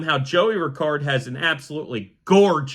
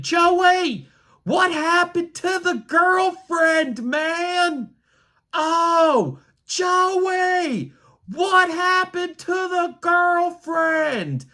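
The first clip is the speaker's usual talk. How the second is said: high, slow, drawn-out taunting yell; obnoxious heckling; 11 semitones higher, much slower than usual. Retold or imitated. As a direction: stretch every word out to carry across the ballpark. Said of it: "I start screaming".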